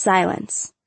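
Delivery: ordinary delivery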